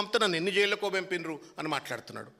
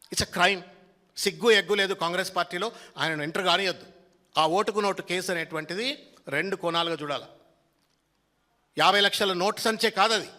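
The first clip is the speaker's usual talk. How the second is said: normal talking voice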